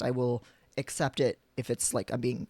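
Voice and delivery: ordinary talk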